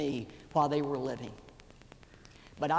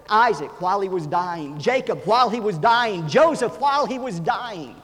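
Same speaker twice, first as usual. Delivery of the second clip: building from strong to a ringing, much higher, much louder cry; preaching at full force; 9 semitones higher, 12 dB louder than usual. Acted. Preacher to congregation about dying patriarchs, building to a much louder, higher shout. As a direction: climb each line until the whole church rings.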